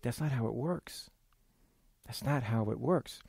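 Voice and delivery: very soft, low, gentle rebuke; quiet correction. Acted. God answering the entitled servant, hushed correction.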